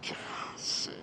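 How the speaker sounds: whisper. Real, not acted. Muttering to self.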